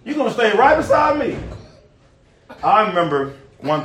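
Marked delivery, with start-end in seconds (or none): 0.1-1.4 Peter's rising rebuke ending in a shout; hammed-up rebuke; rebuking. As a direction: tell Jesus he's not going anywhere. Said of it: "Peter rebuked Jesus".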